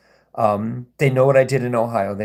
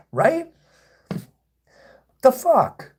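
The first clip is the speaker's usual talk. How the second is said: incredulous; 12 semitones higher, much slower than usual. Real, not acted. Incredulous at the trolls.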